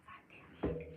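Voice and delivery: retold curse, barely voiced whisper. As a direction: whisper it as a private reaction. Retold muttered reaction to bad news.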